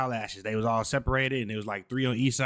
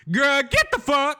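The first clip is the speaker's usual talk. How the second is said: shouting; 7 dB louder, slower than usual. Real, not acted. Commentator shouting at clip.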